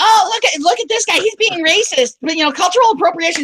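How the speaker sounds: high-pitched mock indignation; exaggerated high accusing voice; mock outraged accusers. People mockingly quoted accusing him of racism.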